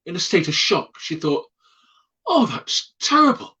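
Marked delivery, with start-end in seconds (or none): 2.3-3.6 a high, crying wail of dismay. Retold or imitated. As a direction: retell her shock with a pitched-up cry. Jeannie shocked after family flees.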